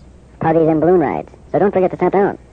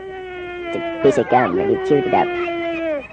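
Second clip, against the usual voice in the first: high slow squeal; 3 dB quieter, slower than usual. Acted. Voice reacting to gum.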